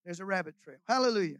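usual speaking voice

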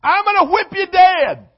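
hammed-up angry parent yell; parent's threat. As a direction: shout it like a furious parent at kids. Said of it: "That's how they talk to us".

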